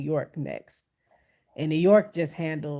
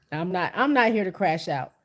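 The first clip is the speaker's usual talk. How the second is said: normal talking voice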